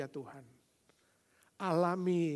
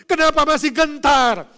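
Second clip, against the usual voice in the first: mock worried believer; 8 semitones higher, 17 dB louder than usual. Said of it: "engkau mengatakan".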